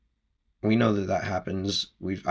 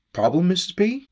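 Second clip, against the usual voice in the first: higher, louder acted greeting; neighbour calling out; 10 semitones higher, 6 dB louder than usual. Neighbour calls over the fence to offer help, calling out. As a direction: pitch it up and call over, imitating a neighbour.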